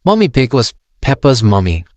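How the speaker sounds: ordinary delivery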